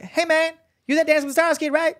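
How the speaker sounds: very high, loud comic hey-man greeting; Chris Tucker. Retold or imitated. Star comedian hailing the young dancer at a party, high squeaky call. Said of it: "Chris Tucker was like".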